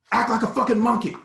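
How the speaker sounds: high, loud hateful yelling; going off on us. Retold or imitated. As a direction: scream the order at the boys. Gunman ordering boys.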